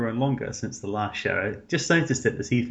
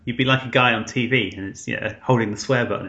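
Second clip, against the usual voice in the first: normal talking voice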